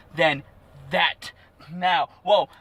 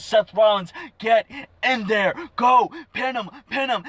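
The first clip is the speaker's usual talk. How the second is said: high, loud, urgent commands; imitating an insistent wrestler giving orders; shouted orders; 6 semitones higher, 4 dB louder than usual. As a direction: bark it like orders from ringside. Acting out Triple H's orders.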